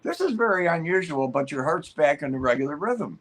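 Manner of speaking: mildly baffled doctor delivering surprising news; puzzled doctor. Retold or imitated. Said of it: "he's looking at it kind of puzzled".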